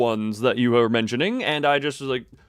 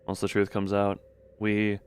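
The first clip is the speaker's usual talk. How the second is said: story narration; 5 semitones lower, 5 dB quieter than usual. A narrator's reading. Reads game narration.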